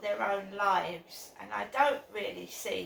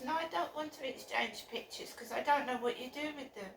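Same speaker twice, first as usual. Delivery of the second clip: normal talking voice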